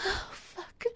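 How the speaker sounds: high, breathless whispered gasp of pleasure; breathy gasp. Acted. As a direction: gasp it out barely voiced. Pleasure outburst in roleplay.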